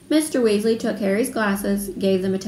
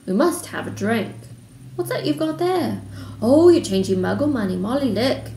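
delighted; 3 semitones higher than usual. A narrator's reading. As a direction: exclaim with growing wonder at a novelty.

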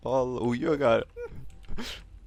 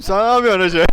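laughing; 8 semitones higher, 13 dB louder, faster than usual. Real, not acted. Laughing at friend's chaos in game.

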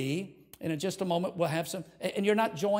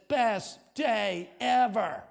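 very slow, higher-pitched proclaimed headline; headline proclamation; 4 semitones higher, much slower than usual. Acted. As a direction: draw out each word like a big front-page headline. Announces imagined heaven newspaper headline.

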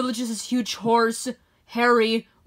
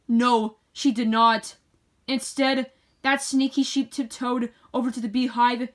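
plain reading voice

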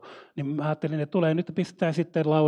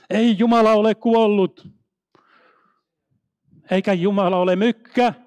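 fervent preaching; 3 semitones higher, 6 dB louder than usual. Real, not acted. Preacher blesses missionaries and declares God is alive, fervent. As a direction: preach it out with full conviction.

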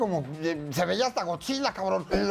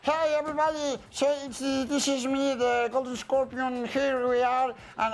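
lightly put-on English accent, slow; 6 semitones higher, slower than usual. Golden Scorpion greeting London fans in mock English accent. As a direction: play a posh Englishman hamming it up. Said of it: "hablando con acento inglés".

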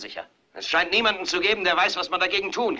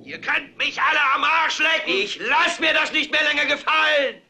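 higher, full-throated bellow; roaring shout; 9 semitones higher than usual. Acted. Anchor roars the slogan to his viewers, bellowing. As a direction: roar it at the whole street. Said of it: "schreit und brüllt".

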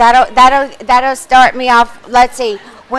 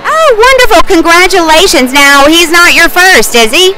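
red carpet host; 6 semitones higher, 5 dB louder than usual. Acted. Live broadcast interview.

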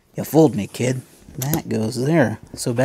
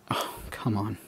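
breathy whispered plea; under-breath exasperated whisper; whispered frustration; 3 semitones lower, 11 dB quieter than usual. Real, not acted. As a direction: hush it out in frustration. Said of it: "unvoiced whisper".

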